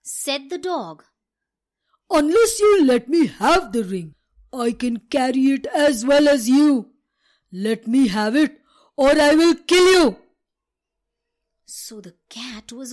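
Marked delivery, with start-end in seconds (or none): 2.1-10.2 loud, menacing dog's threat; threatening dog. Acted. Dog threatening the cat over the ring, loud. 11.7-12.9 whispered narration. A narrator's reading. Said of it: "narration, unvoiced".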